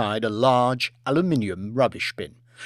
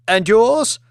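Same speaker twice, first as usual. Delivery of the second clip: pointed question; 9 semitones higher, 8 dB louder than usual. Acted. Lady quizzing guests.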